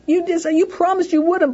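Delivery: put-on high, loud whiny kid complaining; whiny child. Shown as a demonstration. Child whining at the parent who said no. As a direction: whine like a kid who was told no. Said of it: "blah, you know, like that".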